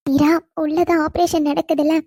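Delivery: ordinary delivery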